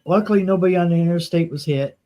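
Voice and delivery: usual speaking voice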